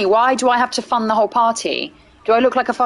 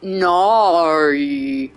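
drawn-out Aussie "No."; "No." said with a broad Aussie vowel; Aussie accent; 4 semitones lower than usual. Shown as a demonstration. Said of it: "Oh, that was better".

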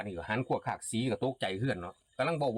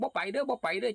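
high frightened cry; 13 semitones higher than usual. Acted. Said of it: "ได้ยินแต่เสียง".

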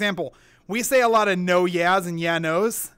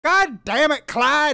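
put-on thick Southern accent; 8 semitones higher, 7 dB louder, slower than usual. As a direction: holler it in an exaggerated Southern twang. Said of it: "his southern accent had a southern accent".

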